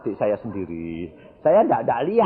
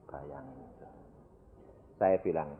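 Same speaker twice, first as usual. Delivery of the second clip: ordinary delivery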